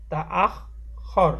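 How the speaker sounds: "আখ খর"-এ খ দুবার, আখ-এর শেষে ও খর-এর শুরুতে; খ-এর দ্বিত্ব উচ্চারণ. Shown as a demonstration. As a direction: split "আখ. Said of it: "এই একবার খ উচ্চারণ হলো".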